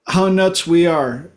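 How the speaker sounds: usual speaking voice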